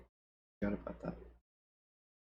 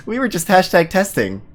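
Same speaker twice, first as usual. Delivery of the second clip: overly formal scientist; 8 semitones higher, 10 dB louder than usual. Acted. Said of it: "overly formal".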